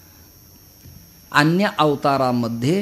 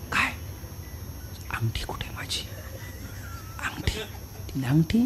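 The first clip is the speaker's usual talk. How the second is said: hushed, whispered question; very soft, almost voiceless; furtive asking; 13 dB quieter than usual. Acted. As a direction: whisper it urgently so nobody overhears. Arjun furtively asks after his ring.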